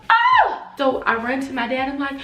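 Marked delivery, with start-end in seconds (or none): 0.1-0.6 much higher, much louder Oh!; shocked shout. Retold or imitated. Teen hearing her mom end the party, shouting. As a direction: cry out in alarmed disbelief.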